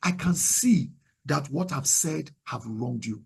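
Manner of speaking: slow, humble admission of fault; low, measured, softening into a sincere sorry; acknowledging apology. Shown as a demonstration. Modelling an apology that acknowledges the wrong, sincerely. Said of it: "there needs to be acknowledgement".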